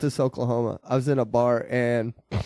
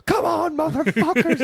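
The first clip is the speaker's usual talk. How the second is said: high, loud mock challenge; cocky bar taunt at the bikers; challenging taunt; 3 dB louder than usual. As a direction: call them on, pitched way up. Challenging bikers to fight, retold.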